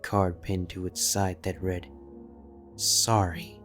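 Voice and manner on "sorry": plain reading voice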